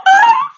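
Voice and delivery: very high loud yelping ah; panicked shriek. Retold or imitated. Teen girl startled when her crush speaks to her, very high shriek. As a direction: squeal in sudden panic. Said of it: "silla, y yo".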